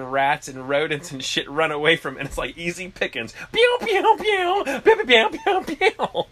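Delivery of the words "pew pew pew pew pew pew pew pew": very high, playful pew pew noises; high pew sound effect. Retold or imitated. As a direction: zap the prey with cartoon pews. Imitating firehawk dropping fire.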